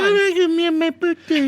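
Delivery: mock indignation. Birthday boy scolds his friend for arguing, mock indignant. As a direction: whine it out in mock outrage. Mock outburst at being argued with.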